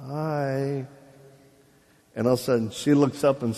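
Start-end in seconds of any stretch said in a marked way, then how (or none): 0.1-0.9 soft sing-song hi; tentative greeting. Retold or imitated. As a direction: say hello gingerly, half sung. Timid greeting to possessed girl.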